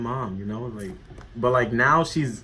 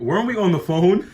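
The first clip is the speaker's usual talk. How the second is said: higher, louder mock disbelief; incredulous retold comeback; exasperated retort; 7 semitones higher, 6 dB louder than usual. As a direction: throw the question back, fed up. Retold exasperated reply to dad.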